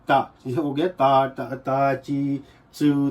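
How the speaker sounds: टा and ता said slowly and clearly, ची चू drawn out; slow syllable drill; teacher drilling ta chi tsu; Japanese ta. Shown as a demonstration. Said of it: "इसको हम पढ़ते हैं टा".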